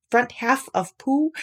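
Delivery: ordinary delivery, nothing marked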